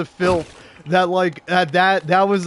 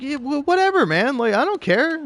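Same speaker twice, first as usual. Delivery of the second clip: high, careless brush-off; high mocking whatever; dismissive; 9 semitones higher than usual. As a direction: wave him off like it's nothing. Dismissive retort retold.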